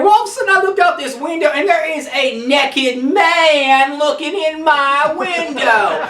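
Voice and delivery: ordinary talk, nothing marked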